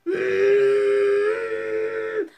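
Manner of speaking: sharp high gasp of sudden pain. Retold or imitated. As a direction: gasp as a contraction grips you. Contraction hits during injection.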